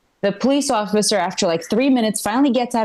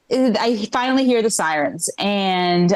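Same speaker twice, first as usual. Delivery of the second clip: ordinary talk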